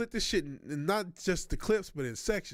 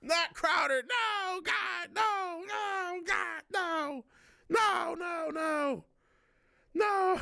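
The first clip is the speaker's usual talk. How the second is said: dismayed wailing no; 8 semitones higher, much slower than usual. Real, not acted. Reacting to Crowder mention.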